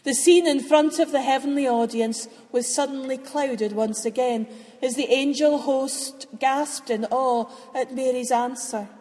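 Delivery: plain reading voice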